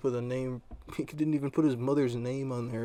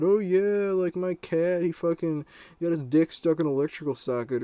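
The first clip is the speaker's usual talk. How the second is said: loud, higher rambling-customer voice; mock customer; 6 semitones higher, 6 dB louder than usual. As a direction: mimic a customer's pointless story, loudly. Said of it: "they tell me stupid stories".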